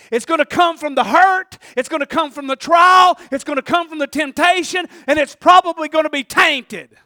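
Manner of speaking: loud preaching building to a high shout; full-force preaching. Real, not acted. Preacher warning congregation against speaking in defense, full-force preaching. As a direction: drive each point harder until you are shouting.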